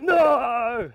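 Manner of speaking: loud frustrated yell; shout. Real, not acted. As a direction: yell no at the board. Yelling at failed try.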